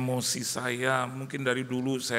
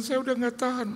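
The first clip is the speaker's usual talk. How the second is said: much higher put-on woman's voice; upset wife; 12 semitones higher than usual. Wife tells pastor she'll throw acid, high voice. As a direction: pitch it way up as the desperate wife. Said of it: "Dia bilang".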